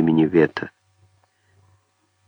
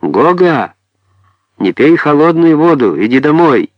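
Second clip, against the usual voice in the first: higher, louder mother's yell; calling out offstage; 6 semitones higher, 4 dB louder than usual. A narrator's reading. Mother shouting to Гога to come home, scolding call. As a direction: project a scolding call to someone far off. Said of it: "и закричала за сцену".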